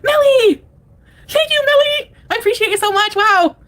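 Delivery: very high, squealed thanks; excited gushing. Real, not acted. Streamer overwhelmed by a viewer's gift, squealing thanks.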